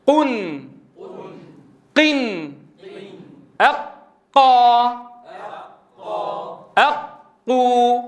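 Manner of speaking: slow drill, ق sealed and released clean in قيل, قن, أقو; qaf without breath. Shown as a demonstration. Teacher pausing for repetition, breathless ق through قيل, أقو, أقي. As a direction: hold أق shut, then open onto a long vowel as in أقي. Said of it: "صوت القاف من غير همس".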